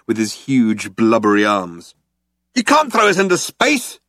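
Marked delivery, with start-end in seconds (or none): 2.5-4.0 high-pitched panicked protest; yelled. A narrator's reading. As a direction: yell it in outrage. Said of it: "yelled Ford".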